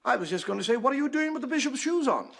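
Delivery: lightly voiced Mother Superior, puzzled. Mother Superior answering the livid sister.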